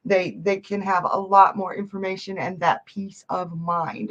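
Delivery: ordinary talk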